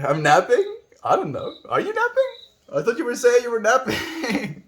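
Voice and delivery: high, loud, playful questioning; mock confusion. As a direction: squeak it out in exaggerated puzzlement, ending in laughter. Mock confused exchange about napping.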